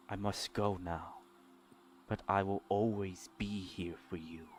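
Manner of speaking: soft, low and tender, sorrow held in; hushed farewell with long pauses, keeping emotion contained; restrained, holding back tears. Acted. Mysterious voice saying goodbye to her child, restrained sorrow. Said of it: "a restrained tone in her voice".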